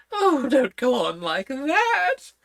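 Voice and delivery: put-on high, fussy old queen. A narrator's reading. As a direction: talk up high like a flustered queen. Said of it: "cried the poor Queen".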